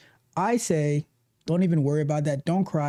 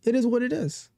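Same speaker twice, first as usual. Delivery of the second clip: easygoing shrug; 5 semitones higher, faster than usual. Retold or imitated. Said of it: "I'm like".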